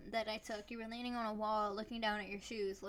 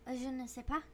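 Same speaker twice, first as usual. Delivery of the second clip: mock French; 3 semitones higher than usual. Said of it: "that is very good".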